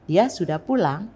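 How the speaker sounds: PULANG rising at the end; questioning intonation. Shown as a demonstration. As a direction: turn it into a question by lifting PULANG. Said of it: "agak meninggi di akhir kalimat tanya".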